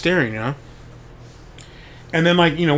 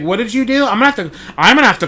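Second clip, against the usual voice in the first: high, accusing question with less force; accusing teacher; 7 semitones higher, 4 dB louder, faster than usual. Retold or imitated. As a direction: ask it again, disbelieving and cold. Teacher still accusing him.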